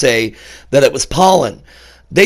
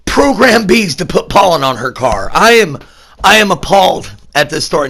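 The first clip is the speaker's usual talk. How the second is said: 1 mock serious; 6 semitones higher, 4 dB louder than usual. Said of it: "I'm trying to be serious and do that type of shtick".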